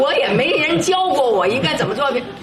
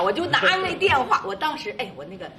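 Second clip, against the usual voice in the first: usual speaking voice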